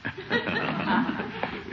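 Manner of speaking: airy rising chuckle at the barber's joke; breathy laugh. Acted. Commissioner chuckling at the barber's joke, airy laugh. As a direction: laugh it off lightly.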